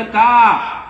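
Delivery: loud startled why; sharp surprise. Retold or imitated. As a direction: bark the question out in shock. Teacher surprised by answer. (आश्चर्याने मोठ्याने)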